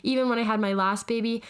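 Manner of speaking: ordinary delivery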